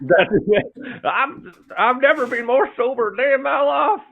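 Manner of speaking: very high, loud prospector voice; stretched-out southern old-timer squawk. Acted. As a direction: crow like a crazy old gold prospector. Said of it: "southern prospector voice".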